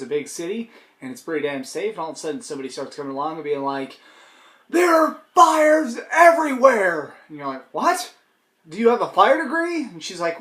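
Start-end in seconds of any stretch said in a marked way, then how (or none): 4.7-7.1 high, slow, loud panicked cry; hammed-up alarm, high and drawn out. Alarmist voiced in mock scenario. 7.7-9.8 incredulous. Acted. Skeptic questioning the alarmist.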